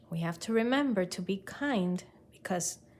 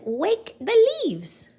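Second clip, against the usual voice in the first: high, loud and slow; strong call; 12 semitones higher, 7 dB louder than usual. Acted. Watney shouts his game order at the class, loud. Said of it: "Watney's voice was stronger".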